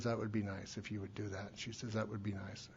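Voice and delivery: a weary, barely-there yes; subdued sister. Retold or imitated. Speaker voices his depressed sister Maggie accepting lunch, very soft.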